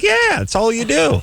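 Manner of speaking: mocking friends. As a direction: tease through laughter up high. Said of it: "they started laughing, like".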